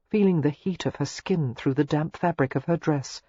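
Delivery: ordinary talk, nothing marked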